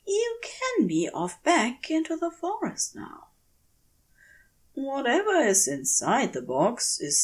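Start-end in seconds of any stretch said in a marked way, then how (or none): 0.1-3.3 gentle coaxing. Acted. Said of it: "He keeps his voice as soft as he can".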